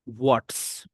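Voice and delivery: ordinary talk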